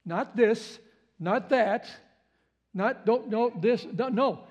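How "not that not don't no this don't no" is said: ordinary talk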